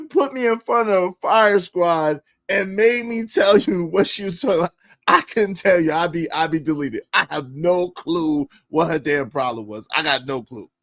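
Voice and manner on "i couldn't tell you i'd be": normal talking voice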